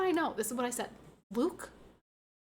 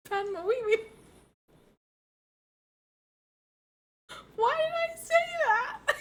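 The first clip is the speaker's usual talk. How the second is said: high-pitched, laughing through the words; giggling at your own silly name for her; real uncontrollable giggles; laughing fit; 13 semitones higher, 4 dB louder than usual. Real, not acted.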